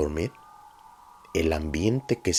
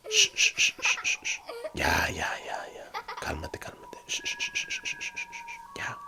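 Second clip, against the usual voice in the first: hushed, breathy shushing to calm a sleeper; soothing shush; 9 dB quieter than usual. Retold or imitated. Roommate calming a friend mid-nightmare, soft soothing shush. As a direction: whisper him out of his nightmare, gently. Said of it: "el gesto o el ruido más tranquilizante".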